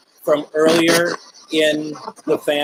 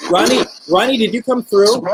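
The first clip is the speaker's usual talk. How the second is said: raised, higher call to a spirit; 7 semitones higher, 4 dB louder than usual. Real, not acted. Medium calling out to Ronnie across the room, raised voice. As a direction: call the name out into the room, louder.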